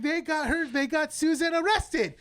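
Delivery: high, slowed, distressed telling; mock panicked voice. Retelling the show, imitating the character's distressed report. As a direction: wail out the bad news. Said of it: "He goes, like".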